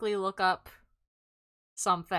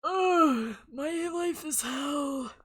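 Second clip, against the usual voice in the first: dramatic sigh; 6 semitones higher, much slower than usual. Pretend. Dramatic complaint.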